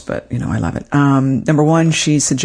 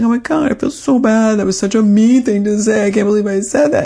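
higher, hammed-up guilt over a mean remark; guilty outburst; 8 semitones higher than usual. Telling her therapist about a mean remark, mock-anguished and higher. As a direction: play your past self beating yourself up, pitched up. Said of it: "and I was like".

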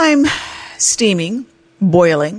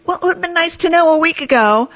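sarcastic retort; 12 semitones higher than usual. Retold or imitated. Snapping back at the clerk.

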